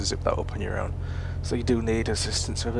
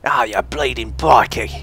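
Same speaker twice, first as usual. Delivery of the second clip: slow, loud mock-Aussie insult; bad Australian accent; 6 dB louder, slower than usual. Insulting an imagined mate, hammed-up slow Australian accent. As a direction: drag it out in a clumsy Australian accent. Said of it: "I can't do the Australian accent very well".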